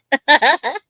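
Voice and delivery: a quick amused giggle. Real, not acted. Laughing at her own hint about the goal, short rising giggle.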